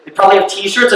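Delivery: ordinary talk, nothing marked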